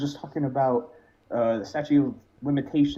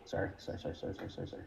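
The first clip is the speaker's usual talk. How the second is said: very soft, repeated apologies under the breath; hushed apologising; 5 semitones lower, 14 dB quieter, much faster than usual. Real, not acted. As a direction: murmur a string of sorries.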